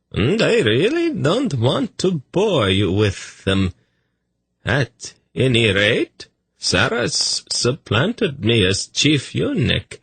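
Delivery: a languid courtier recounting; high, languid eunuch narrator; dreamy eunuch. A narrator's reading. Tzadi continues.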